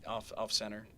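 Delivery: ordinary talk, nothing marked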